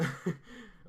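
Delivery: breathy little giggle. Real, not acted. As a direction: let out a quick embarrassed giggle. Giggle after naming artist.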